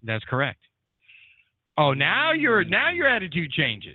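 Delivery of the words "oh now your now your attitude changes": teasing. Real, not acted. Teasing the co-host.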